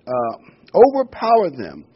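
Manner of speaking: preacher's dramatic emphasis; emphatic repeat. Acted. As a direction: drive the word overpowered home. Rereads with emphasis.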